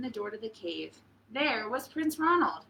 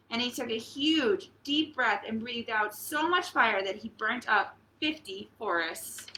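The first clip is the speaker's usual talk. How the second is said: usual speaking voice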